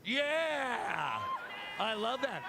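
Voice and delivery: high loud whoop; excited cheer. Real, not acted. Cam cheering a fan from Japan, loud whoop.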